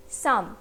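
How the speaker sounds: "साम" low, one syllable closing on M; pronunciation demo. Shown as a demonstration. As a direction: model "साम" with only an M at the end. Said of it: "का pronunciation क्या होगा". ("साम" का उच्चारण नीची आवाज़ में, अंत में म)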